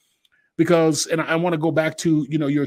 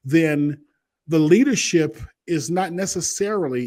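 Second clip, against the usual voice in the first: ordinary talk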